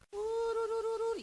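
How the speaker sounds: trilled "tururu" call; shepherd's call. Retold or imitated. Shepherd calling his sheep.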